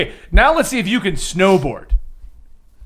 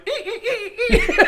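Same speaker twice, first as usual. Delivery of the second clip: squeal; 7 dB quieter, much faster than usual. Retold or imitated. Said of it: "and you're just like".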